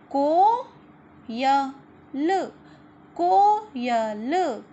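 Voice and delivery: मोर loud, the second slowly drawn out; read-along word. Shown as a demonstration. Teacher reading words aloud for children to repeat, slow and loud. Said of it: "मेरे साथ आप लोगों को भी पढ़ना है".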